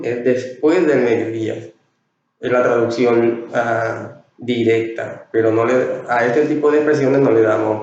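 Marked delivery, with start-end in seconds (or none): none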